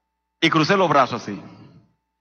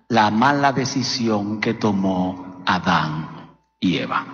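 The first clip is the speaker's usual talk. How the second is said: slow solemn preaching; 6 semitones lower, slower than usual. Acted.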